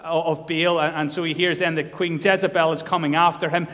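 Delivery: ordinary talk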